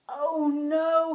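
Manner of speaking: high, loud dismayed cry; cry of alarm. Acted. Hickory seeing Willow in the trap, loud cry. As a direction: cry it out in shock. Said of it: "he cried".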